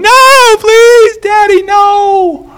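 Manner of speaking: put-on high, loud wailing plea of a little girl; wailing child. Daughter wailing at dad over timeout, high and loud. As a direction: cry out like a child dragged to her room.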